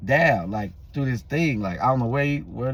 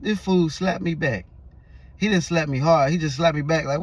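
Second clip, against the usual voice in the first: normal talking voice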